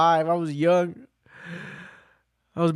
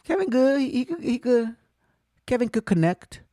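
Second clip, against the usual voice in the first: imitating peers; 4 semitones higher than usual. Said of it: "they're gonna be like".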